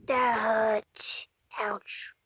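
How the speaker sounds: a tiny, hurt whisper; breathy whispered ouch. Pretend. Pretend hurt toy character.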